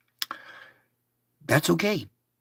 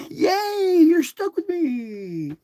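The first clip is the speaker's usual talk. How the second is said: much higher squeal, slow; squeaky cheer; much slower than usual. Pretend. God cheering to the listener in a squeaky voice. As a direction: squeal it with glee.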